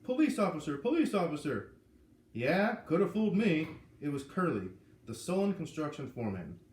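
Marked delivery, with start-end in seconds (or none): 0.0-1.7 loud commanding police shout. A narrator's reading. Dazed officer shouts to the open car door, loud and commanding. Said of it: "and shouted". 2.3-3.7 sarcastic. A narrator's reading. Sullen foreman mocks officer.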